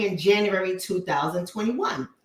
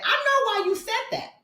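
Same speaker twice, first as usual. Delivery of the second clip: mocking sing-song; 12 semitones higher than usual. Mocking a commenter's thought.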